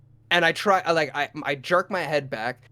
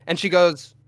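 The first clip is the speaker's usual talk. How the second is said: ordinary talk, nothing marked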